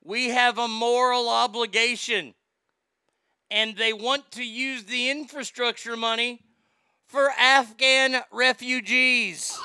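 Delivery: quoting her in a loud mocking tone; mocking quote. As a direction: read it like a ridiculous quote. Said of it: "and I quote".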